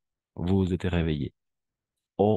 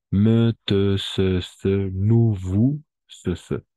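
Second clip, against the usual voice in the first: loud, high, each pronoun punched; emphatic list; 3 semitones higher, 7 dB louder, much slower than usual. Shown as a demonstration. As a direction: drill the pronouns out loud, one by one. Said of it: "entonces son estos". (enumeración enfática, cada pronombre marcado)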